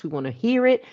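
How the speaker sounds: usual speaking voice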